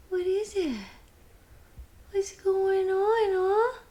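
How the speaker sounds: high, slow cooing questions. Real, not acted. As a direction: coo curious questions at the cat. Talking to curious cat.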